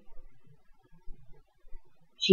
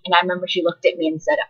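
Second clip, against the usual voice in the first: usual speaking voice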